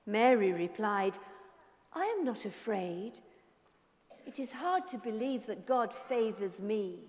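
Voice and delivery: soft storytelling voice; story narration. A narrator's reading. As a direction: tell it gently to children.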